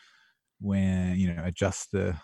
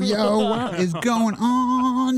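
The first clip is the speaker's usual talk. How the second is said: put-on American accent; 10 semitones higher than usual. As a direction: hype it up, American style. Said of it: "your Donald Trump impression".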